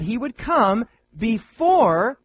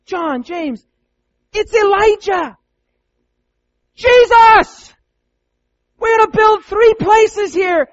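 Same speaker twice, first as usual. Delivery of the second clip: very high, very loud, slow shouting; wide-eyed yelling disciple; excited Peter; 12 semitones higher, 11 dB louder, slower than usual. Acted. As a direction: shout it like a man just jolted awake. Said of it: "he's like".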